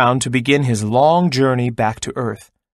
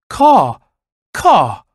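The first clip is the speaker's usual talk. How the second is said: high, loud cawing crow call; 5 semitones higher, 6 dB louder than usual. A narrator's reading. Crow trying to sing but only cawing, loud caw. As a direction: caw like a crow, rough and sharp. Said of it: "all he could say was".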